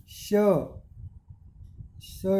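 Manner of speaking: श then स, each sound held apart; isolated sh and s sounds. Shown as a demonstration. Teacher contrasting श and स sounds. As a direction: say श, then स, clearly separated. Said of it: "और स के उच्चारण में बहुत थोड़ा अंतर है".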